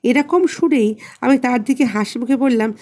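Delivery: ordinary delivery, nothing marked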